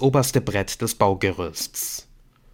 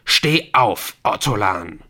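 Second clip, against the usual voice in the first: firm, louder order to a captive; stern captor giving a command; 4 dB louder, slower than usual. Acted. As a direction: order him to his feet. Armed captor orders victim up.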